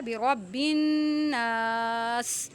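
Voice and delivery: برب الناس ending on a still س; pausal form. Shown as a demonstration. Teacher showing the stop at the verse end, slow chant. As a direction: draw out الناس and stop dead on the س. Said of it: "اس کو ہم نے ساکن کر دیا".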